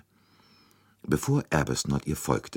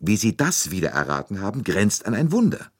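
marvelling, climbing higher and louder; admiring exclamation; 6 semitones higher, 4 dB louder than usual. A narrator's reading. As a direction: exclaim at a small miracle. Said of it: "rief er".

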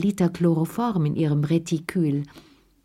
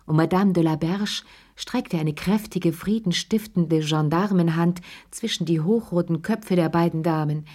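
plain reading voice

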